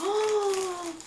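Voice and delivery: high, drawn-out "oh"; long amazed gasp. Real, not acted. Reacts to gift. (long « oh » aigu émerveillé)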